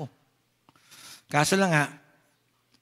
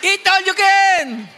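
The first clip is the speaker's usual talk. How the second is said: slow, loud and much higher, shouting to the crowd; shouted invitation; 12 semitones higher, 10 dB louder, slower than usual. Real, not acted. Preacher shouting a feast invitation to the congregation, slow and loud. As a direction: belt it out like a joyful announcement.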